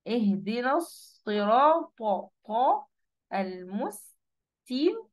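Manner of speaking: slow "الصراط" with heavy ص and ط, "المستقيم" with light س; careful recitation. Shown as a demonstration. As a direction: recite "اهدنا الصراط المستقيم" piece by piece, slowly. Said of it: "سين أهي".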